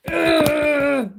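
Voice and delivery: loud, strained groan of disgust; disgusted ugh. Real, not acted. Reader groaning at the ex who drained her, loud disgusted ugh. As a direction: let out a fed-up, pained ugh. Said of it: "that's how I feel about him".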